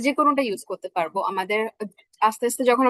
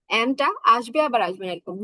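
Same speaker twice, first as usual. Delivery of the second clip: normal talking voice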